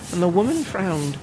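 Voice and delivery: ordinary delivery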